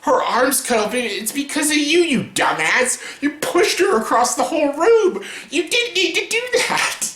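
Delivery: mock outrage aimed at Edward; scolding Edward. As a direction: tell off a dumb boyfriend. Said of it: "I'm like".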